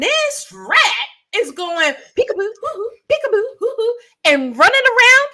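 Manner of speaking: high, loud, sing-song taunt; teasing rat voice. Retold or imitated. Telling the podcast host how the rat taunted her, high sing-song. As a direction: play the rat mocking her from under the game. Acting out the rat's antics.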